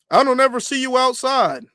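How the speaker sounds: lightly voiced street taunt. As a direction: call them out mockingly. Taunting quote, louder, higher.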